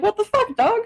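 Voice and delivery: high incredulous yelp; mock outrage. Reacting to the ex moving away, high disbelief. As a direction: squeak it in disbelief.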